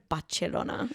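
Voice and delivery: "Barcelona" as Bachelona; Italian-style pronunciation. Shown as a demonstration. Showing co-host her new way, "Barcelona" with ch. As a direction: put a ch in the middle of "Barcelona". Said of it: "that's how they say it".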